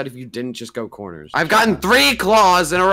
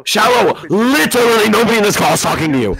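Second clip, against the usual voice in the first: loud, higher-pitched telling-off; fast, raised-voice outburst after the name; exasperated shout; 5 semitones higher, 6 dB louder, faster than usual. Real, not acted. Player telling Shallow nobody is talking to them, exasperated shout.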